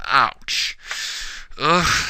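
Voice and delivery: ordinary delivery, nothing marked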